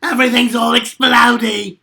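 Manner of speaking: loud, shouted Australian Junkrat; second take, Australian locked in; better Junkrat. Shown as a demonstration. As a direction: go full Aussie and blow it up. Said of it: "That was better".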